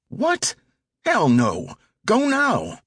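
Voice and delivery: put-on pushy teenage getaway driver; impatient teen driver. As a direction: snap at him from the driver's seat. Driver pushes partner to go.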